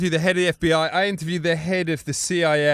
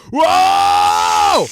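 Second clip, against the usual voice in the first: long loud rising whoa, much higher; frat-boy whoop; 13 semitones higher, 9 dB louder than usual. Retold or imitated. Naked wrestler cheering, a loud rising whoop. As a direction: let out a big party whoop. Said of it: "he goes".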